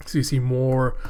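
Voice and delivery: ordinary talk, nothing marked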